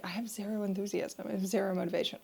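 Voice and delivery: quiet, deflated and listless; unmotivated. Retold or imitated. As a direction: sigh it out with zero energy. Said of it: "days like this where you're like".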